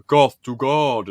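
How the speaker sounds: slow, loud, theatrical; mock goth drawl. Fan quoting Richmond in court, slow and theatrical. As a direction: intone it like a gloomy goth. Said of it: "and he's like".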